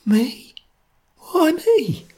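very high, thin squeak; small frightened high voice; faint, appalled question. Acted. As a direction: ask faintly, aghast.

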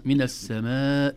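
السماء with a long "maa" before hamza, loud; مد متصل. Shown as a demonstration. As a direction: stretch the آ in السماء. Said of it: "الهمز في الكلمة والمد في الكلمة". (السماء بمد قبل الهمزة)

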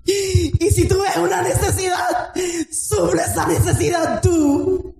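high, shouted sermon climax, slowed on key phrases; fiery preaching. Real, not acted. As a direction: preach it out like a revival call. Preaching at full force. (predicación encendida a gritos)

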